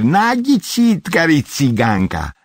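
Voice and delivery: high, drawn-out crowing; excited cry. A narrator's reading. Red-haired girl crowing that he guessed wrong, excited cry. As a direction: crow it out high and slow. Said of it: "strigă el".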